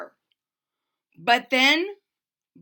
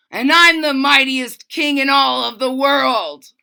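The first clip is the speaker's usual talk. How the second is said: outraged king; 3 semitones higher, 10 dB louder than usual. A narrator's reading. King shouting that kings never apologise.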